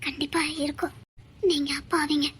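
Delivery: hushed, soothing promise to her husband; soft reassurance. Acted. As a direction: say it softly, like a secret comfort. Wife soothing husband softly. (மென்மையான ஆறுதல் குரல்)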